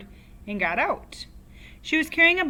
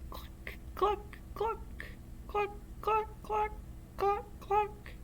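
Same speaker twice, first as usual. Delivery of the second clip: hen clucking; 12 semitones higher, 8 dB quieter, slower than usual. Acted.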